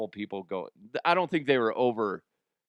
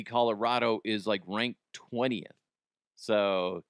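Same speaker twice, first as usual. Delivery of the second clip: normal talking voice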